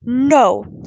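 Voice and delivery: loud, forceful refusal; defiant. A narrator's reading. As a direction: refuse point-blank. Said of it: "she glared at her mother in defiance".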